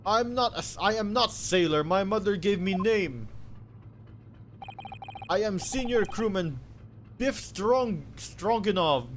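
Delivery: put-on loud, proud Russian accent; Russian sailor accent. Sailor introducing himself, loud Russian accent.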